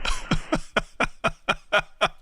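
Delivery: long, steady run of chuckles; real laugh. Real, not acted. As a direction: laugh helplessly before answering. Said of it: "I, I, I'm laughing".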